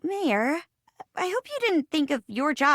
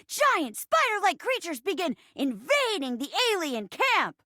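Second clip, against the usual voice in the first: high, urgent action narrator; excited narration; 7 semitones higher than usual. A narrator's reading. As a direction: narrate the attack with pitched-up alarm. Narrating a sudden invasion.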